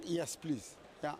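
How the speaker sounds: ordinary talk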